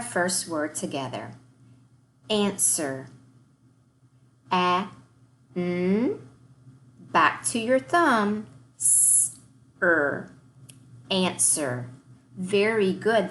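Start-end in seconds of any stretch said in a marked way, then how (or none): 4.5-6.2 "Answer" split into separate sounds, one at a time with a pause after each; segmented sounds. Shown as a demonstration. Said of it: "start our warm-up by segmenting". 8.8-10.3 "Answer" second syllable split into "S" and "er", each sound on its own; segmented sounds. Shown as a demonstration. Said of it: "start over by tapping that thumb".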